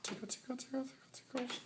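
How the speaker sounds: high sing-song tickle chant; squeaky playful tickle voice; giggly tickle attack. Pretend. Tickling sleeping friend, sing-song.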